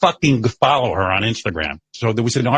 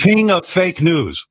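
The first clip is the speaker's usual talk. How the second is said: slow, higher-pitched mock proclamation; mocking insult reading; 7 semitones higher, slower than usual. Host reads hate mail about himself, with mock grandeur. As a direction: announce the insult like a mock title.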